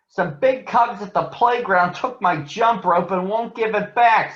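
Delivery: high, loud little sister complaining; upset little girl. Acted. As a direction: tell on the big cubs, outraged. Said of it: "Sister said".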